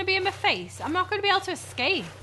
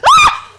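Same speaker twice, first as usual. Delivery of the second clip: a real high shriek of fright; terrified scream; 15 dB louder than usual. Real, not acted. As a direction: scream in sudden terror. Monster jumps out, screams.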